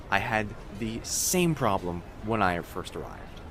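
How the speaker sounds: ordinary talk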